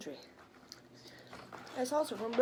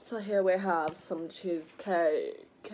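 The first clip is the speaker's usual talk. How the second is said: slow, mannered food introduction; put-on presenter voice; 3 semitones lower, slower than usual. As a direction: present the dish slowly in a put-on voice. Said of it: "if I talk like that".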